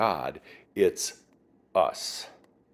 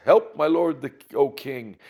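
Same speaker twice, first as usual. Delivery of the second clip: raised, louder cry for help; 7 semitones higher, 8 dB louder than usual. Retold or imitated. A woman begging the king for help, crying out. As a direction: call out in appeal to a lord. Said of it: "a woman cries out to him".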